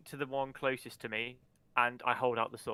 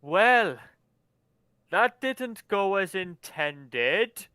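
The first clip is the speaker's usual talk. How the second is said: louder, slow and wry; dryly theatrical, slow, louder; dry theatrical remark; 5 semitones higher, 9 dB louder, much slower than usual. Acted. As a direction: savour each word with mock disappointment. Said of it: "he will say".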